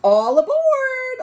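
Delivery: high, loud, slow call. Acted. Oscar calling the dogs onto his raft, loud cheerful call. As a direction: call everyone aboard like a captain. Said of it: "Oscar called".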